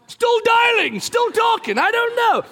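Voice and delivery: high, loud strained outburst; exasperated dad. Acted. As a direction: snap back loudly at nagging kids. Harried dad answering kids' repeated questions.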